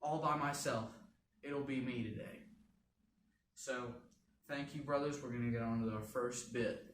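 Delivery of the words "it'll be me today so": normal talking voice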